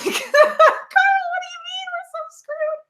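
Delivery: squeaky high laughing question; can't stop laughing while asking; laughing at the translator's bad news; hysterical laughing. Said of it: "hysterically laughing back and forth".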